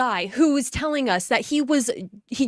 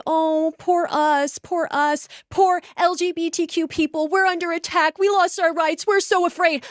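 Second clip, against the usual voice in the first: mocking whiny victims; 6 semitones higher than usual. Mocking liberals as they claim to be victims, hammed-up whine. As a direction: sneer it out like a self-pitying victim. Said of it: "So then they could say".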